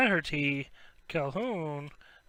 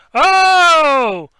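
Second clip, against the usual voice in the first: loud high exclamation of delight; a sudden thrilled cry; excited yelp; 10 semitones higher, 14 dB louder than usual. Real, not acted. Breaker spotting a big hit, shouting a loud high yelp. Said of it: "Just got too excited".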